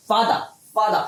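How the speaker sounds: "ফাদা" with no final R; British "father" said as "ফাদা"; British pronunciation. Shown as a demonstration. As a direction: end "ফাদা" on an open vowel. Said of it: "এইভাবে হলো গিয়ে".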